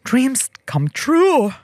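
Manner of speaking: high, slow, drawn-out dreamy exclamation; blissed-out, lingering high delivery; retold elation. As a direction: stretch it out high like a mock-sung wish come true. Retold elated reaction to getting internship.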